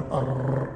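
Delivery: أر with the ر rolled repeatedly; over-trilled R. Shown as a demonstration. Tajweed teacher warning what not to say, rolled ر in أر. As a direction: wrongly trill the ر of أر into a string of taps. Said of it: "فلا تقل".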